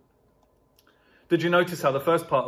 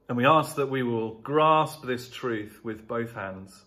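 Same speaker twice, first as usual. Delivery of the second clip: prayer. Acted. Closing prayer.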